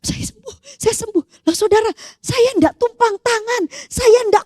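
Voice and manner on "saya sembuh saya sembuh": high, soft, tearful joy; crying. Retold or imitated. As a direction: weep out the praise. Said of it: "cuma nangis".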